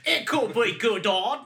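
high, loud hammed-up British accent; mock British accent. Friend topping the Liverpudlian attempt, silly high British accent. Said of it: "do it more Liverpudlian".

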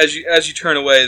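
usual speaking voice

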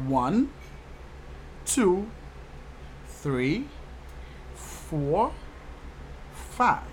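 one, two, three, four, five said in English, each number set apart; English counting. Shown as a demonstration. Teacher counting for learners, one to five in spaced-out English. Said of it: "in English, you say".

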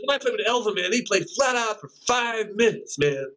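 a light take on Joe bragging about Elvin; imitating Joe. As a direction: raise the pitch and quote the old bandleader with a shrug. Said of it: "He says".

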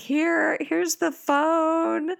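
stunned high squeak passing the phone; overwhelmed squeak. Retold or imitated. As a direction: gasp it out, too stunned to talk. Handing phone over, overwhelmed.

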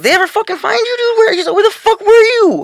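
high, incredulous mock neighbor voice; neighbor shocked. Retelling the neighbor at the door, high incredulous voice. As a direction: play the stunned neighbor who thought you vanished.

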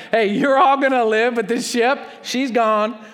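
hammed-up upbeat Paul; cheery bad news. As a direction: deliver grim news cheerfully.

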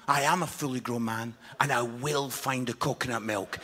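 mock dignified reply. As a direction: put on a hammed-up grown-man gravity. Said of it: "I says".

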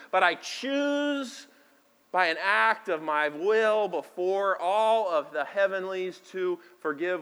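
slow, drawn-out solemn vow; earnest declaration. Acted. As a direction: proclaim it slowly, like a vow before heaven. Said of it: "declares choice of forgiveness".

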